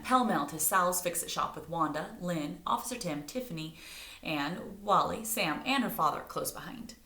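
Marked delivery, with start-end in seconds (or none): none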